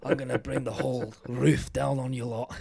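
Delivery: hammed-up Scottish actor threat; Gerard Butler. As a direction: threaten in a put-on Scottish accent. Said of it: "I'm channeling my, my butler".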